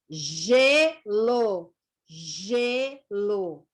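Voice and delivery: gelo stretched as "zhe - lo", full final o; syllable-by-syllable reading. Shown as a demonstration. Teacher modelling reading for beginners, slow syllables in gelo. Teacher sounding out example words.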